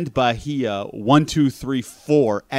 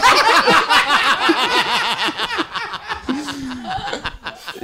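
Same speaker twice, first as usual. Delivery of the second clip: high wheezing laugh; 4 dB louder than usual. Real, not acted. Laughing at own story.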